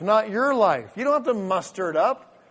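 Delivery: usual speaking voice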